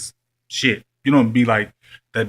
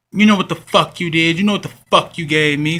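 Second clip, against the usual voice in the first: higher, louder mock accusing yell; hostile accusation; 6 semitones higher, 5 dB louder than usual. Ex-fling accusing him of giving her something, louder mock yell. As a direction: throw the accusation at him, imitating her rage. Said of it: "she just hostile as shit".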